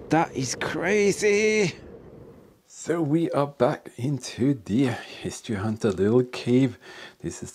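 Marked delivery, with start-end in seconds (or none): 0.1-1.7 slow, high astonishment; amazed. Real, not acted. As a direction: draw it out in disbelief. Marvelling at the find.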